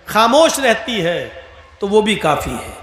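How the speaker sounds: ordinary delivery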